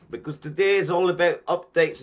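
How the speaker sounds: "today is all about updates" in a light put-on Scottish accent; bad Scottish accent. Vlogger announcing the topic in a mock Scottish accent. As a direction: finish the line still in a half-hearted Scottish accent. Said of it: "I'm gonna quit doing this Scottish accent".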